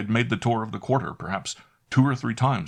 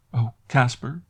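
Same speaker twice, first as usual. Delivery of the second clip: high, urgent call; anxious cry; 5 semitones higher, 4 dB louder than usual. A narrator's reading. Maid crying out to Caspar, distressed. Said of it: "cried Annette".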